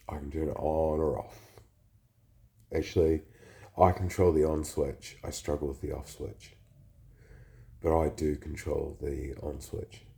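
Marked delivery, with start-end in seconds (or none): none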